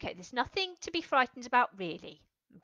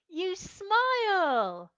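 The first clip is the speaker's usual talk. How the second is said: smiling voice; 6 semitones higher, 4 dB louder, much slower than usual. Shown as a demonstration. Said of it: "what happens to your face when you're happy".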